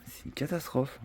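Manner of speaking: ordinary talk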